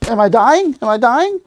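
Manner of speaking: high, alarmed little voice, half joking; mock panic. Retelling being struck, mock high panic. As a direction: ham up the fear of being hurt. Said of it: "I went".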